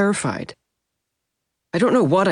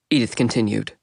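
plain reading voice

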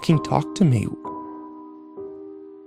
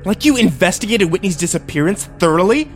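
higher, biting sarcastic question; spat accusation; 7 semitones higher, 3 dB louder than usual. A narrator's reading. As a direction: spit it out as an accusation. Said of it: "I spat".

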